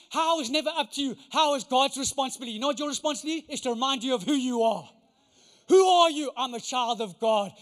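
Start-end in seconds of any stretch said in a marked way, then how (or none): 5.7-6.3 preacher's call. Acted. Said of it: "call and response".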